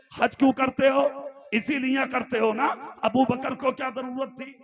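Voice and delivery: loud, high fiery sermon voice; preaching at full force. Acted. Preacher exhorting the Talakpur crowd, fiery sermon delivery.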